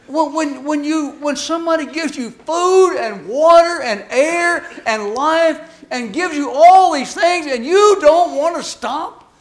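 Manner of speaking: loud, high and slow; fervent sermon rebuke; preaching at full force. Real, not acted. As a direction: hammer home the ingratitude. Preaching indignation.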